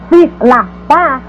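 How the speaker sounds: "sílaba" dito em três partes, sí-la-ba; separado em sílabas. Shown as a demonstration. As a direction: separar cada sílaba de sílaba com pausas. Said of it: "estou usando três sílabas". (sílaba silabado)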